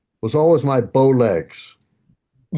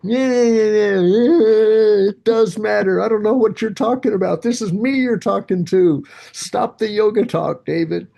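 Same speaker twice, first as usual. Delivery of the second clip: sarcastic inner self; 8 semitones higher than usual. Retold or imitated. His other self mocking the yoga talk, sarcastic. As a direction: brush it off like a sceptical friend. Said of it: "myself looks back at my other self and goes".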